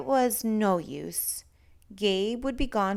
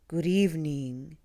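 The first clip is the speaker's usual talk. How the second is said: a lightly lower, breathy monster greeting; 4 semitones lower than usual. A narrator's reading. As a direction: breathe it out low like a gentle monster. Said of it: "said a low, breathy voice".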